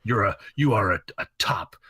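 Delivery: a lightly played Triple H giving hard news; Triple H impression. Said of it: "That is kind of a good Triple H".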